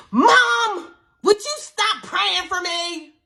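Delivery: imitating the possessed daughter calling out; possessed girl. Possessed girl confronts praying mother.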